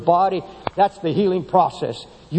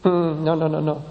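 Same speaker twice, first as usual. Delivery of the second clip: normal talking voice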